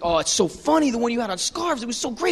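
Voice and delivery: mock fawning praise, rising at the ends; gushing fan. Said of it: "Jerry just starts gushing".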